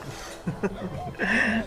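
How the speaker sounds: a high, easy laugh at his friend; amused laugh. Acted. Friend laughing at the overworked guy's excuse. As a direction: laugh along at the joke. Friends teasing at drinking party.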